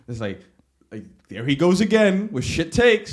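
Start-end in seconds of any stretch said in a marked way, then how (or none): 1.3-3.1 slow, louder mocking jeer; mocking chat voice. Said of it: "I have an emote that's like".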